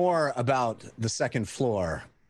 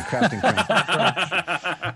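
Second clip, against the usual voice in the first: slow laughing repeat; 4 semitones higher, 5 dB louder, much slower than usual. Shown as a demonstration. Host savoring a viewer's funny name, laughing slowly. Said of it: "I love that one".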